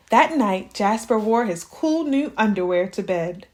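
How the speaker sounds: ordinary delivery, nothing marked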